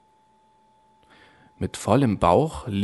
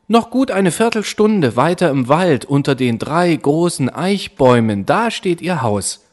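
put-on higher, bright child voice, helpful; girl's voice; 6 semitones higher, 4 dB louder than usual. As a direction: sing it out like a trusting little girl. Rotkäppchen gives directions.

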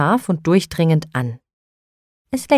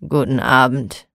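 low, sullen "Guten Abend" grunted without looking up; grumpy grumble; 6 semitones lower than usual. A narrator's reading. Grumpy broom-maker answering Frau Holle, low sullen mutter. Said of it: "brummte mürrisch".

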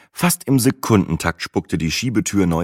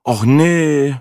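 louder, higher dismayed groan; 8 semitones higher, 5 dB louder than usual. A narrator's reading. Narrator groaning at the old man joining, dismayed. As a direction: moan it, fed up. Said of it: "stöhnte ich".

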